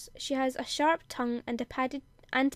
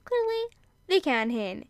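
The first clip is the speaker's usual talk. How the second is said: mock smug retort, higher start; sarcastic aside; 4 semitones higher, 3 dB louder than usual. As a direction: tack on a cheeky jab. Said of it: "that's not in the quote, that last bit".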